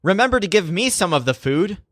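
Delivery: understated storybook shout; mild narrated shout. A narrator's reading. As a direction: call it out lightly as in a story. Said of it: "enters the scene and shouts".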